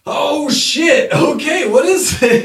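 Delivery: high startled oh shit, half laughing; mock startled teacher. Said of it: "was like".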